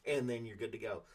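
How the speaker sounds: normal talking voice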